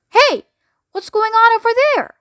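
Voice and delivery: startled by something in the lifts. A narrator's reading. Hotel manager sees something coming.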